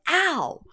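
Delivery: high-pitched pretend "ow"; mock cry of pain. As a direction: imitate someone yelping in pain. Imitates a person getting hurt.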